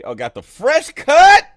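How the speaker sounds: high, loud playful shout; loud, delighted ribbing; excited teasing. Real, not acted. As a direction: call out the new haircut with glee. Teasing friend's look.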